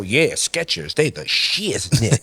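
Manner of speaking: low, slow laid-back rapper drawl; [name] voice. Retold or imitated. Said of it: "and go".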